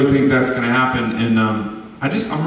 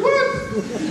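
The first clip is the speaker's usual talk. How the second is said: high squeaky disbelief through a laugh, much higher; stunned what; 4 dB quieter than usual. Retold or imitated. Telling the pastor's news, a laughing high-pitched shocked what. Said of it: "I'm like".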